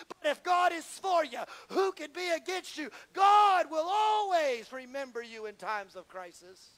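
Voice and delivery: loud, high, impassioned preacher climax; roaring sermon crescendo; preaching at full force. Acted. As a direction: preach it hard, building to a shout.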